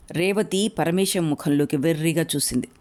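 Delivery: plain reading voice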